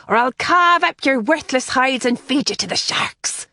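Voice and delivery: swagger like a stage pirate; pirate brogue. Acted. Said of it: "cried in a piratical brogue".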